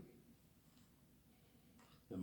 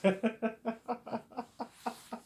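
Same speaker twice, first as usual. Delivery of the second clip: high giggling burst; high laugh. Real, not acted. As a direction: break into laughter. Laughing at own acting.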